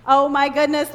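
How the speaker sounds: ordinary delivery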